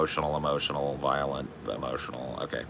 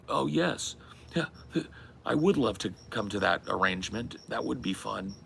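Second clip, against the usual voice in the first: lightly voiced polite Frank the Tank, pitched up; mock-proper party guy agreeing sweetly; 5 semitones higher, 4 dB quieter than usual. Imitating a movie party guy accepting an invite, mock-polite. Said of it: "Where that guy's like".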